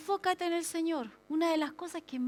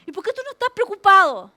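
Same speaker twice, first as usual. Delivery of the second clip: higher, mock-indignant questioning; gente que reprocha; 4 semitones higher, 9 dB louder than usual. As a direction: reproach them in disbelief, building louder. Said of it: "hay personas que no lo entienden". (reproche incrédulo y agudo, cada vez más fuerte)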